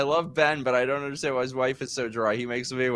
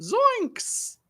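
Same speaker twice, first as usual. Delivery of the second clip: high, cracking Shaggy yelp; a badly faked Shaggy exclamation; cartoon slacker voice pitched way up. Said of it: "Can I do it?".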